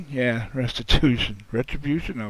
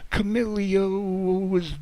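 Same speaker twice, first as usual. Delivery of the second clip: ordinary talk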